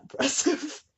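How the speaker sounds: ordinary talk, nothing marked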